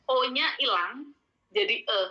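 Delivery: ordinary talk, nothing marked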